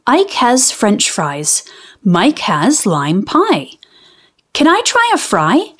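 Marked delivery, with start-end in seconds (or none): 4.5-5.7 put-on high, quick little-boy asking; eager little boy. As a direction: ask for a fry like a hopeful kid. Mike asks for a fry.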